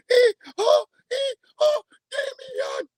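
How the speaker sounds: very high, squeaky falsetto mimicry; Damien song voice. Man imitating his voice before it dropped, squeaky falsetto. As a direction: chant it in a tiny high falsetto. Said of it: "you had the Damien song voice coming out".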